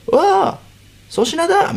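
mock loud greeting. Co-star spotting him outside the restroom, delighted shout. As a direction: yell his name in happy surprise. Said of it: "またこれ大声で言ってきた".

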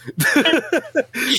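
laugh. Real, not acted. Laughing at own joke.

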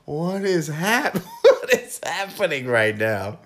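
slow, loud, laughing bewilderment; mock astonishment broken by laughter; laughing disbelief. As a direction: act baffled while cracking up. Amused retelling of baffled reaction.

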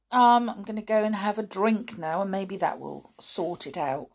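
usual speaking voice

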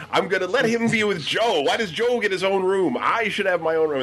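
high-pitched indignant protest; complaining brother. Retold or imitated. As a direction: argue like an older kid demanding his due. Brother complaining about sharing room.

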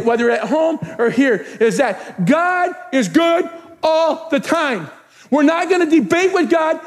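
stretched, ringing declaration; emphatic preaching. Real, not acted. Pastor drawing a line for the church, emphatic preaching. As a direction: drive each word home to the church.

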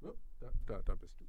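tender murmur to a little animal; soft cooing. Pretend. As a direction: coo gently at a hidden pet. Greeting the hidden bunny.

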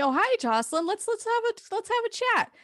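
very high, fake-sweet boss voice; mock surprised boss. Owner surprised to see Jocelyn, very high fake-sweet voice. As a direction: impersonate the owner's phony cheer. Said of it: "and she's like".

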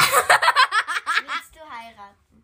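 dragged out, loud through giggles; can barely ask it for laughing; giggling loudly through a cheeky question. Real, not acted. Giggly question in kids' game.

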